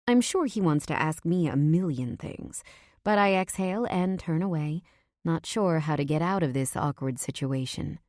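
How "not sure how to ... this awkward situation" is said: ordinary delivery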